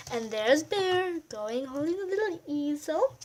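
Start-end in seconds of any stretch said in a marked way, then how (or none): none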